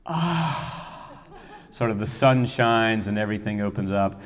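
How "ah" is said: high, long breathy "ah"; awed sigh. Retold or imitated. Conductor imitates the room as the fifth door opens, awed sigh. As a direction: imitate an audience's sigh of wonder. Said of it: "everyone in the room just kind of goes".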